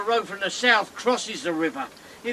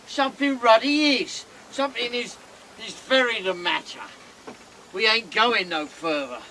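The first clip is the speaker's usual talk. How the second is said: ordinary talk, nothing marked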